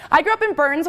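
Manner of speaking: usual speaking voice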